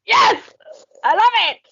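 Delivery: high, loud cheer; real whoop of delight; delighted yes. Real, not acted. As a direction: shout it in pure glee. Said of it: "I was like".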